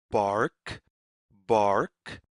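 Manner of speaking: usual speaking voice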